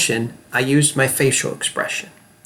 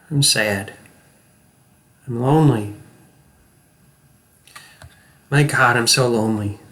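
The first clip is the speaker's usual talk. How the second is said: sad. Shown as a demonstration. Acting coach demonstrates sadness and loneliness to students. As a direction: cry out your loneliness. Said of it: "I want you to watch me".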